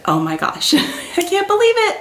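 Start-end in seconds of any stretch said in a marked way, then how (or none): 1.2-2.0 very high, laughing squeal; excited squeal. Real, not acted. Vlogger thrilled about her new bag, squealing. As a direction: squeal it through a giggle. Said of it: "Okay, I kinda just squealed".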